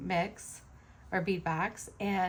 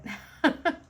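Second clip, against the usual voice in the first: short high burst of laughter; bright laugh; 10 semitones higher, 6 dB louder than usual. Real, not acted. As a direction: laugh out, amused. Laughing at own story.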